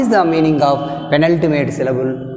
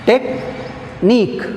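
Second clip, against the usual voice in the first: Technique very slow and higher, split tech... NIQUE; stress on last syllable; 6 semitones higher, much slower than usual. Shown as a demonstration. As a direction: pause inside Technique, then stress the long nique. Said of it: "stress on".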